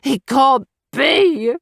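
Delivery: slow, anguished denial; devastated disbelief. A narrator's reading. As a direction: drag it out in grief. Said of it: "a cry so devastated".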